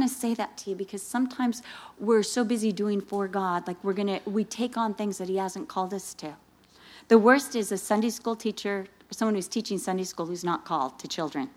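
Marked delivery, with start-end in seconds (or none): none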